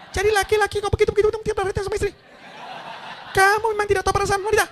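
high, fast falsetto mock wife; husband hears his wife scolding him, high fast mock nag; nagging wife. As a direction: mimic a shrill-voiced nagging wife, quickly. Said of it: "mulai timbul teriakan-teriakan".

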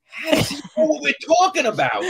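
high, loud bewildered outburst; baffled guest. Retold or imitated. Confused guest at the table overhearing the story, baffled. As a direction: blurt it out in acted confusion.

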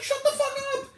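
explosive yell at the woman; furious shout. Retold or imitated. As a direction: scream it at the talker. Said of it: "I'm just like".

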